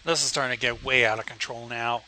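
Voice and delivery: ordinary delivery, nothing marked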